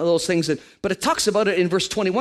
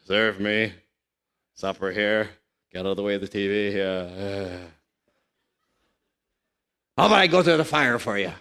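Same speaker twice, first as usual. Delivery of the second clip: low, gruff lord-of-the-house orders; bossy husband; 9 semitones lower than usual. Retold or imitated. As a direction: play the lazy husband barking demands. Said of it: "We walk around like a".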